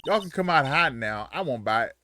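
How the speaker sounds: teasing Southern woman; Southern accent, playful. Acted. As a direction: coax them out in a twang. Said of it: "Why they make her Southern?".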